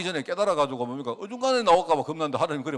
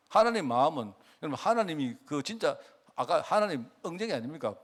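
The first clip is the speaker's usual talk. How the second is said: normal talking voice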